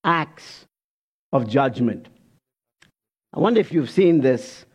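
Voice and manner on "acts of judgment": acts of judgment set apart with a pause; pause, then land acts of judgment; weighty pause before judgment; emphasized phrase. Shown as a demonstration. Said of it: "here's the phrase".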